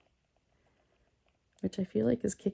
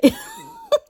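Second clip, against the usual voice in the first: a sudden high squealing laugh; 3 dB louder than usual. Real, not acted. Laughing at her own coffee-microwaving confession, high squeal.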